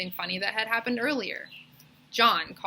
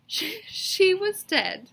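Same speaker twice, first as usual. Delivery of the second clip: slow, halting, broken up; choked-up; 12 semitones higher, 4 dB louder, much slower than usual. A narrator's reading. Narrator reaches the sad death in the story, choked-up. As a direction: let the words catch.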